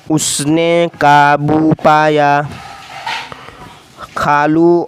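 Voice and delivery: teacher reading a sentence slowly. Shown as a demonstration. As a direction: read it out slowly word by word. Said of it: "ये आसान आसान जुमले हैं".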